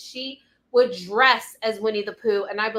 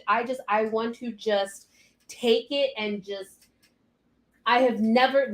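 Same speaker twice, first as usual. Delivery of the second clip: ordinary talk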